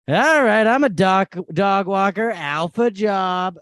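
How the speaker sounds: big, loud, proud self-introduction; loud announcement. Retold or imitated. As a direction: announce it loudly and slowly. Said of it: "I was like".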